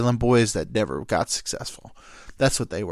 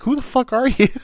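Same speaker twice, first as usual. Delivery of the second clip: high, loud indignant demand; mock angry yelling; 13 semitones higher, 5 dB louder than usual. Buddy to the stranger eating his food, acted outraged yell. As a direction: imitate an irate buddy challenging a stranger. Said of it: "so mad that he got ye-, they yelled it".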